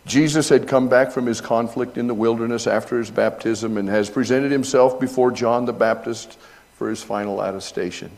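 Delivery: normal talking voice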